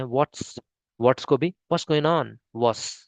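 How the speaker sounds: normal talking voice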